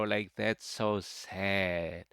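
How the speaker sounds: slow, soft and sorrowful; sad. Acted. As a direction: let it droop, slow and sad. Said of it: "That sounds sad".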